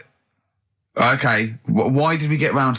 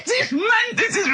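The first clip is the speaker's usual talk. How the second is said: high, helpless laughter; cracking up, barely able to speak; laughing fit. Real, not acted. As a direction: laugh through the words.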